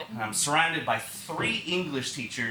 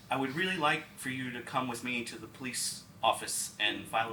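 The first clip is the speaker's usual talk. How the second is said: grave disclosure; 5 semitones lower, 7 dB quieter than usual. Retold or imitated. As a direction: break the news quietly, pausing. Doctor C breaks grave news.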